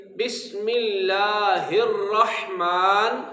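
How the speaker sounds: اللہ joined into الرحمن, slow; slow joined recitation. Shown as a demonstration. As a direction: link the ha to the ra. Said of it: "ہا کو آگے را کے ساتھ ملائیں گے".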